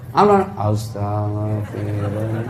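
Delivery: slow, soft, low pretend sob on astagfirullah; mock tearful istighfar; fake crying. Shown as a demonstration. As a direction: whimper it as if weeping, put on. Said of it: "Sambil pura-pura menangis".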